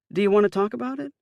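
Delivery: high, quick motherly question; Ma asking gently. A narrator's reading. Mother asking her son to open up. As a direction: ask Mom-style, fast and high. Ma voiced in story.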